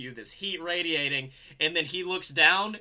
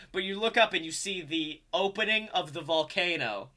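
normal talking voice

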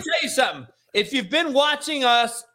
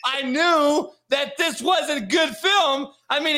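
ordinary talk

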